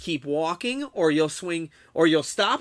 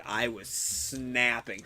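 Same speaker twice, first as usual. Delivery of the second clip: "snapping" stretched slow and lower; drawn-out emphasis; 4 semitones lower, 6 dB quieter, much slower than usual. Real, not acted. Streamer repeats his confession to chat, slow "snapping". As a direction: draw out "snapping", dropping the pitch. Repeats how mad he was.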